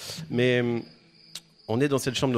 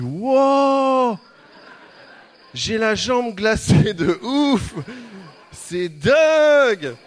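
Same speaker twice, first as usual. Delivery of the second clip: much higher and louder, slow drawn-out wow; stretched, shouted fake astonishment; hammed-up amazement; 9 semitones higher, 8 dB louder, much slower than usual. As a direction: oversell it for the camera. Overacting amazement for the camera.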